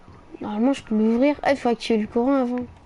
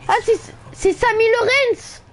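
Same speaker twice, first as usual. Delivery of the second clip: excited; 11 semitones higher than usual. Real, not acted. Excited recognition.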